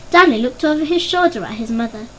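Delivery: ordinary delivery, nothing marked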